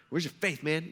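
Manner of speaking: normal talking voice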